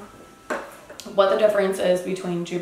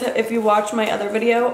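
ordinary talk

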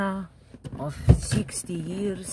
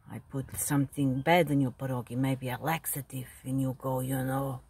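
ordinary delivery

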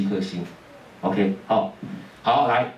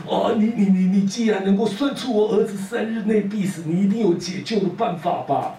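higher, stammering plea; pleading father; 9 semitones higher than usual. Acted. Father begging Guan Lu to save his son, higher and stammering. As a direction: beg as a frantic father.

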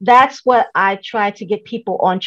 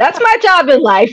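real laugh, higher and louder; laughing exclamation; 9 semitones higher, 5 dB louder than usual. Real, not acted. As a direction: say it loudly through laughter. Joking reply amid shared laughter.

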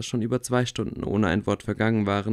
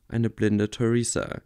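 plain reading voice

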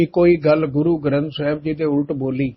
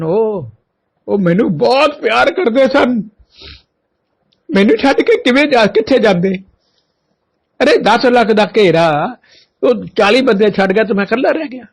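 impassioned lament; 5 semitones higher, 3 dB louder than usual. Retold or imitated. Guru's voice, emotional lament.

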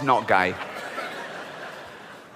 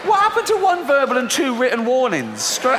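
outraged rant; 8 semitones higher than usual. Real, not acted. Ranting at God's overreaction.

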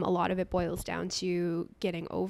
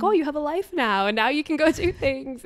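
higher, louder imitation of a delighted grandma; happy grandma; 6 semitones higher, 6 dB louder than usual. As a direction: cheer her on as grandma would. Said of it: "because she's like".

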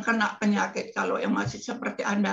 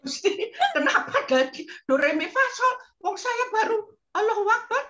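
laughing; 13 semitones higher than usual. Real, not acted. Laughing complaint to God.

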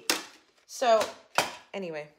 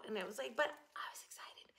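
unvoiced, fast aside; whispered aside; 16 dB quieter, faster than usual. Real, not acted. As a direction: whisper it in self-defence. Aside after being teased.

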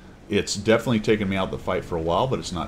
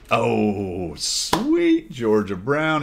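drawn out slowly, pitched up and louder; amazed exclamation; 5 semitones higher, 5 dB louder, much slower than usual. Real, not acted. Player watching a teammate's big hit: slow amazed exclaim. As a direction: savour the surprise, stretching every word. Teammate makes a great shot.

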